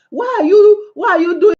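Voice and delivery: exasperated high-pitched scolding; scolding shout. Real, not acted. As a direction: shout the question at them. Scolding husbands.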